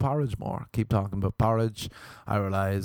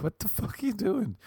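high, hushed incredulous question; shocked disbelief; 11 semitones higher than usual. Retold or imitated. Comedian reliving waking up beside her, high-pitched disbelief. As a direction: react in mock shock, pitched way up.

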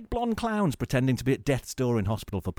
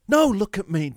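show-off shout; 9 semitones higher, 8 dB louder than usual. Pretend. Extrovert vs introvert act.

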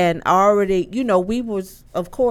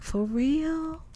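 disbelieving for real; 8 semitones higher, 8 dB quieter than usual. Retold or imitated. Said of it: "And I was like".

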